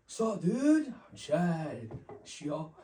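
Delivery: high, loud dudebro greeting; frat bro Chad. Retold or imitated. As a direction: swagger in like a party frat guy. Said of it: "I imitated a frat person named Chad".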